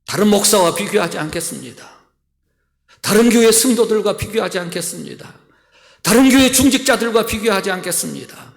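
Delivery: loud, high impassioned prayer; a pastor voicing his own prayer to God, loud and high; fervent preaching prayer. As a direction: pour out each thanks at full force.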